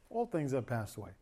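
softened repeat. Shown as a demonstration. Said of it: "Can I just say this to you guys?".

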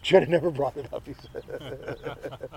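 quick echo breaking into real laughter; amused, laughing as he quotes. Real, not acted. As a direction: repeat the heckle through a chuckle. Preacher echoes heckler, audience laughing.

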